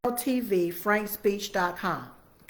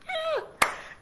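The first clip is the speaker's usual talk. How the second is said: short high burst of laughter; amused high giggle; 4 dB quieter than usual. Real, not acted. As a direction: let a quick high laugh escape. Laughs after her quote.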